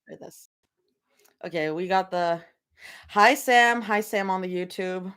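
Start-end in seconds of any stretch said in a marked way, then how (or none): none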